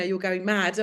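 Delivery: usual speaking voice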